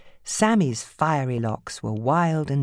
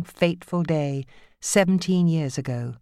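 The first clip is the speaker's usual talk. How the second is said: ordinary delivery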